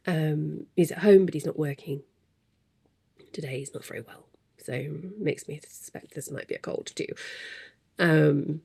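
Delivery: usual speaking voice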